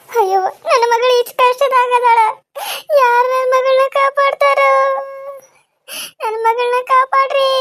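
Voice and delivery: crying out with long, high wails; wailing in tears. Acted. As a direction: wail and plead like a desperate mother. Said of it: "ಅಳ್ಬೇಡ ಅಮ್ಮ".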